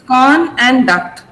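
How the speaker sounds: CON and DUCT slowly set apart; slow teaching split, CON... and... DUCT; syllable by syllable. Shown as a demonstration. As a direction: sound out each syllable slowly. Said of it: "These are the two syllables".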